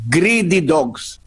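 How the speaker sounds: ordinary talk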